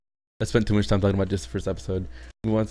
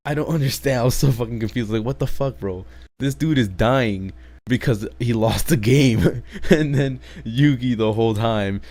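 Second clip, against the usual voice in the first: exasperated rant; 3 semitones higher, 5 dB louder than usual. Real, not acted. Ranting about grandpa dying.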